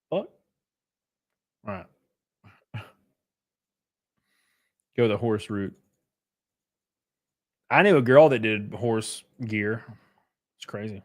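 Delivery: normal talking voice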